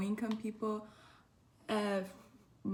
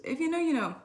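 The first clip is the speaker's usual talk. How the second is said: knowing catchphrase; 7 semitones higher, 7 dB louder, faster than usual. Acted. Catchphrase aside to viewers.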